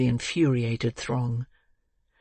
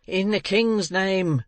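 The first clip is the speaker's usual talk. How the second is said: crowd's cry; 5 semitones higher, slower than usual. A narrator's reading. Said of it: "cried the voices without".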